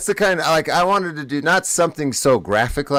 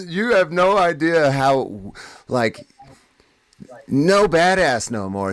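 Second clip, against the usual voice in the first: laughing; 6 semitones higher, slower than usual. Real, not acted. Laughing at his own prank story.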